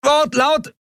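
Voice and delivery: high yell over the din; high-pitched far-off call; distant shout. A narrator's reading. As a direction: shout one word across the noise. Shouted answer through engine noise.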